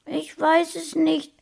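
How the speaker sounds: put-on high, lightly tearful child voice; a touch of a child's sob; sobbing. A narrator's reading. Small boy answering his friend, mildly sobbing. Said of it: "schluchzte Sascha".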